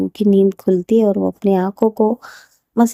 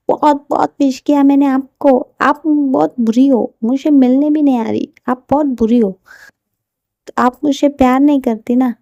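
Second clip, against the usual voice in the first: put-on child voice; 5 semitones higher, 4 dB louder than usual. Child Ruh voiced by narrator.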